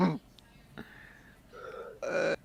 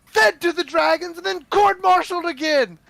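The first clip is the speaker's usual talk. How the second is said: drill sergeant yell; 11 semitones higher than usual. Acted. Warrior scolding the raider soldiers, sergeant's yell. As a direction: tear into them like a furious officer.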